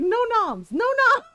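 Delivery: high, loud insistent no noms; squeaky refusal. Retold or imitated. Husband laying down the rule, high squeaky insistence. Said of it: "He's like".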